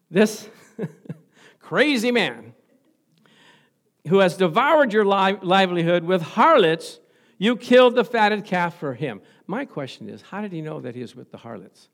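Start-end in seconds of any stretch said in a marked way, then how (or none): 0.1-9.2 loud, slow, higher voice; indignant older son. Retold or imitated. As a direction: spit out "crazy man" in outrage. Older son railing at father.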